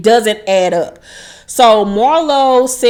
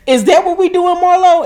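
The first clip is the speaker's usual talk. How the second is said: imitating a high, loud, offended comeback; mock indignant challenge, high and louder; 10 semitones higher, 4 dB louder than usual. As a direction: throw it back at her, pitched up and loud. Acting Drew's offended comeback.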